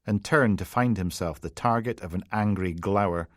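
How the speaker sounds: ordinary delivery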